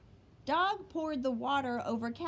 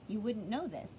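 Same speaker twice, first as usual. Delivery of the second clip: ordinary delivery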